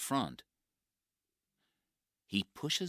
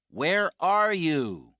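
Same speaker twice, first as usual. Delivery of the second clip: calling out; 12 semitones higher, 14 dB louder, slower than usual. Acted. Calling out to hidden stranger.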